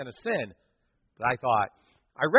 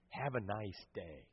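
soft, slow farewell; 8 semitones lower, 19 dB quieter, slower than usual. Shown as a demonstration. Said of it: "Words have power".

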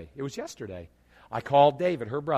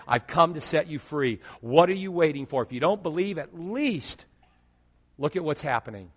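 preaching at full force; 2 semitones higher, 5 dB louder than usual. Real, not acted. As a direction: drive it home to the congregation.

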